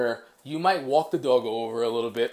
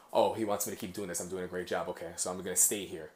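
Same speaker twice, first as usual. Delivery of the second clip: lower, softer dog's inner monologue; low, understated dog voice; voiced dog thoughts; 5 semitones lower, 5 dB quieter, faster than usual. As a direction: think aloud quickly as the contented dog.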